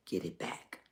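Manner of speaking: whisper. Real, not acted. Urging lost joy back.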